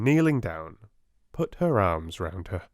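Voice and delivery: ordinary delivery, nothing marked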